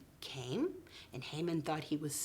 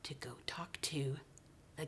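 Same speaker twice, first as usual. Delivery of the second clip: low hush for a fearful moment; hushed whisper; 4 semitones lower, 12 dB quieter than usual. A narrator's reading. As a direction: whisper it like a scary secret. Storyteller stresses the queen's fear.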